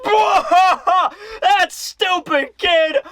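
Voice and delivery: high, slow guffawed jeer; mocking crowd laughter. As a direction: jeer like a whole crowd cracking up. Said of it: "breaks out into laughter".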